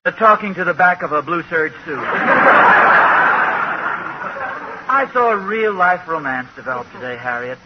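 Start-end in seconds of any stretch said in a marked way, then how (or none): 2.0-4.8 breathy laugh. Real, not acted. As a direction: laugh at your own joke. Laugh after his joke.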